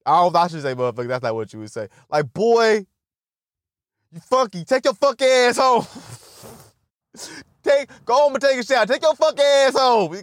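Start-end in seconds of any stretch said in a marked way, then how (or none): 2.3-5.9 hammed-up boss ordering him out; bossy manager. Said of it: "imagine the power as a boss". 7.6-10.1 hammed-up boss ordering him out; bossy manager. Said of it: "imagine the power as a boss".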